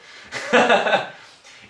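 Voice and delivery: loud, high burst of laughter; amused laugh. Real, not acted. Laughing at a viewer's cheeky question.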